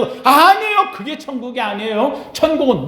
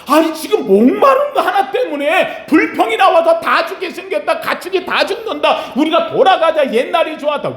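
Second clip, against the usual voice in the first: mock complaining; 8 semitones higher than usual. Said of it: "이들에게 불평을 했어요".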